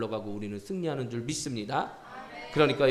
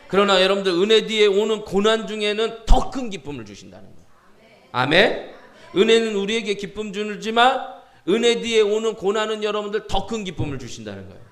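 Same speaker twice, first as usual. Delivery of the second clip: high, loud, insistent preacher; impassioned preaching; 6 semitones higher, 7 dB louder than usual. Acted. Preacher promising greater joy in suffering, impassioned. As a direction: hammer the promise home to the congregation.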